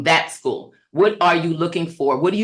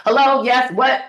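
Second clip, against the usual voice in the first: high, distracted phone pickup; harried staffer; 7 semitones higher than usual. Shown as a demonstration. Staff member picking up while changing diapers, harried and abrupt. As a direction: answer as if interrupted mid-task. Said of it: "they're trying to change diapers".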